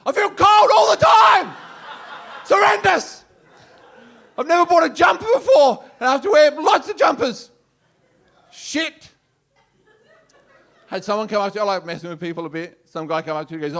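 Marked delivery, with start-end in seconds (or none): 0.1-9.1 mock outraged rant. Comedian to people praising weight loss, loud high rant. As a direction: rage comically about feeling cold. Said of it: "I'm like".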